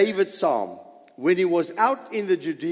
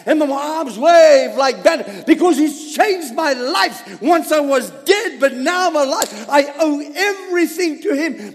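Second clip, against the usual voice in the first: higher, louder, fervent preaching; full-force preaching; 11 semitones higher, 9 dB louder than usual. Real, not acted. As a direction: shout the praise to the church.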